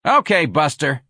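high, loud call. Acted. Frida calling to Ignatius through the gate, loud and tough. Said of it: "Frida called through the gate".

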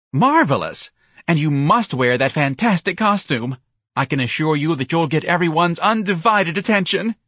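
high, loud gushing; delighted shriek. Acted. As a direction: squeal with theatrical enthusiasm. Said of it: "the young man shrieked".

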